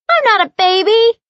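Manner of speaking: put-on very high, loud little girl shout; indignant child yell. A narrator's reading. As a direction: insist like a cross toddler. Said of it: "Bella yells".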